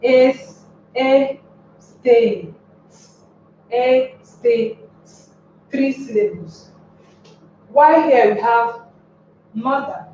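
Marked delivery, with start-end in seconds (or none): 0.0-4.8 estate broken into separate beats, e, then state; syllable by syllable. Shown as a demonstration. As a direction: sound out estate in pieces with pauses. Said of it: "rather it has three syllables".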